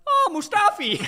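high, loud mock German accent greeting. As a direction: call his name out in an overdone German accent. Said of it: "in a German accent".

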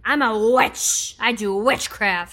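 slow, drawn-out witchy proclamation; hammed-up drunken witch chant; drunk witch screaming. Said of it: "Gianni said I was screaming".

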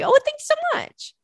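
very high gushing thank you; delighted thanks. Real, not acted. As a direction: squeal the thanks. Thanking a fan's kind message.

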